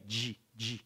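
G said softly as "djee"; Brazilian D sound. Shown as a demonstration. Teacher isolating the syllable, soft "dji" for G. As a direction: repeat G as a short, low "dji". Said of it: "In Brazilian Portuguese".

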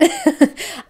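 short, high, loud laugh; real laugh. Real, not acted. Vlogger calling herself beauty illiterate, laughing. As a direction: burst into a brief laugh at yourself.

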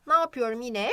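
ordinary talk, nothing marked

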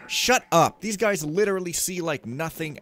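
loud, high-pitched complaint; exasperated outburst. Real, not acted. Player fed up with the guards, exasperated outburst. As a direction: burst out loudly in exasperation. Snapping back about the guards.

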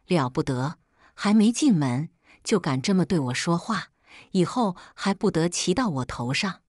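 usual speaking voice

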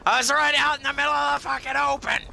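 high loud fast rant; angry rant. Real, not acted. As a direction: rant in outrage. Ranting about the dragon.

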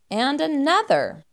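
ordinary delivery, nothing marked

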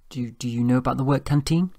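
high, tentative stammered question; nervous invitation. A narrator's reading. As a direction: stammer shyly, lifting to a question. Shy Doris nervously invites him.

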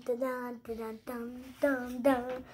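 ordinary talk

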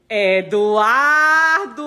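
very slow, drawn-out Eduardo; calling out. Real, not acted. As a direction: call him long and stretched. Calling son to the camera. (chamando Eduardo bem arrastado)

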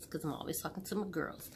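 quick breathy whisper; whispered aside. Real, not acted. Vlogger explaining to viewers, quick whisper.

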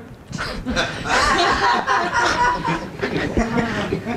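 real rising laugh; high laughing burst. Real, not acted. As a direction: break into a laugh. Preacher laughs at own joke.

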